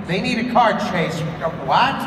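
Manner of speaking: ordinary talk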